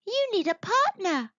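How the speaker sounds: high monkey character voice; clever monkey. A narrator's reading. Monkey tricking the monster, high coaxing voice. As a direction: suggest it in a high, sly voice.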